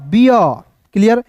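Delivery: ordinary delivery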